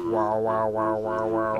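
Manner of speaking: low, droning wobbly wows; imitating a didgeridoo. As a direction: drone it out like a didgeridoo. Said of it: "all I'm thinking is just a didgeridoo".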